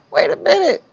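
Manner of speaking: ordinary talk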